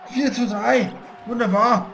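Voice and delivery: loud goal celebration yell; triumphant shouting. Real, not acted. (lauter Torjubel)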